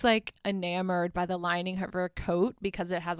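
ordinary delivery

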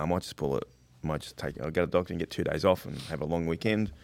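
musing to oneself. Retold or imitated. Sick worker mulling a day off, quietly musing. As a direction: retell a private thought, low and soft.

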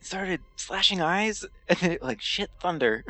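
high, babbling witness account; distracted witness. Dazed character telling officers about the eagle, distracted. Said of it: "swatting at a fly while I'm saying".

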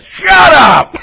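loud, high "Shut up!" breaking into a laugh; laughing shout. Retold or imitated. Pastor acting Jesus yelling at a demon, laughing shout. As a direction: yell it half joking. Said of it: "I interpret it as".

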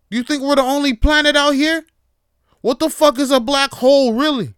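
imagined rant at an office stranger, loud and hammed-up; mock tirade. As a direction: yell a hammed-up rant at a small talker.

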